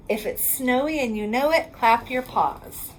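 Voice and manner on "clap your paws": usual speaking voice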